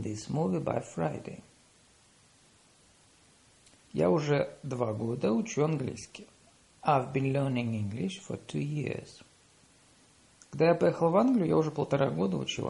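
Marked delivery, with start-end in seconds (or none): none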